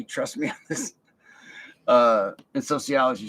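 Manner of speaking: usual speaking voice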